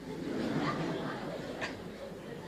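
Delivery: a real, unvoiced laugh at his own story; amused real laughter; long laugh. Real, not acted. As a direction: let the laugh roll out.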